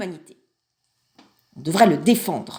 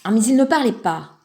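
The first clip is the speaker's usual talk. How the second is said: ordinary delivery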